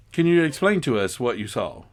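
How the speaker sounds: ordinary talk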